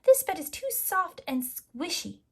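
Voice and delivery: put-on high little-girl grumble; child unhappy with a squishy bed; mumbling Goldilocks. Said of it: "she mumbled".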